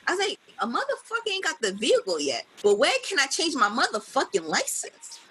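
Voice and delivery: ordinary talk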